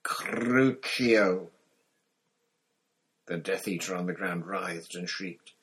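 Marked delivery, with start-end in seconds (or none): 0.0-1.5 loud, forceful spell cry; casting a curse. A narrator's reading. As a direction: hurl the curse at your victim. Villain casts a torture curse.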